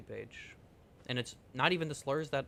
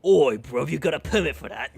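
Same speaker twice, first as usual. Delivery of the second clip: put-on much louder British accent, demanding; British accent shout; 12 dB louder than usual. Mocking a British official checking permits, loud British accent. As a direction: bark it like a British copper. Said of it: "The U K government".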